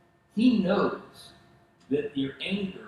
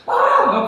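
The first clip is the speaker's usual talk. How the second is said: high sharp startled scream, hammed up; a passenger's sudden alarmed shriek; mock shriek; 12 dB louder than usual. Wife startled by a car, hammed-up shriek.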